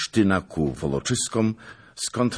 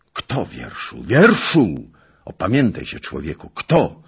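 alarmed outcry; 7 dB louder than usual. Acted. Zagloba yells at the messenger, shocked and loud. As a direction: shout at him to come to his senses.

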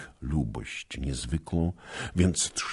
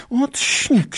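very high, loud taunting call; a girl teasing mid-duel; mocking repetition; 9 dB louder than usual. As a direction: toss it off while striking. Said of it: "powtarzała, przycinając".

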